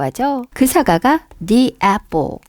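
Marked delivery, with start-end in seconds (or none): 1.5-2.4 "the" in "the apple" said as "dee"; long ee vowel in "the" before "apple"; "thee" before a vowel. Shown as a demonstration. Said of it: "모음 앞에서 우리 D라고 발음되죠".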